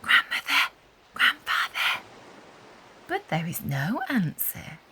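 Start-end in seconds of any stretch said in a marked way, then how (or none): 0.0-2.0 whisper. A narrator's reading. Anna waking sleeping grandparents, gentle whisper. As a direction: breathe the names so as not to wake them. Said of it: "Anna Hibiscus whispers".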